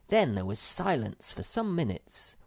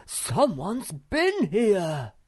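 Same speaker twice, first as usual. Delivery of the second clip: higher, louder, slow cry of alarm; discovering the cave was entered; alarmed shout; 10 semitones higher, 7 dB louder, much slower than usual. A narrator's reading. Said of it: "shouted out in alarm".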